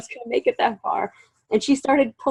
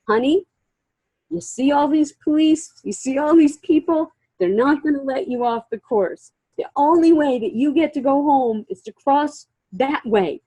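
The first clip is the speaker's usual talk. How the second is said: raised, emphatic voice reasoning with someone panicked; imitated firm persuasion; 6 semitones higher, 4 dB louder than usual. Helper talking a panicked runner into finishing, imitated emphatic persuasion. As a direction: spell it out urgently, point by point.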